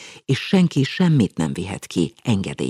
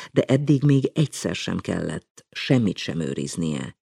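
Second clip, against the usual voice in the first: usual speaking voice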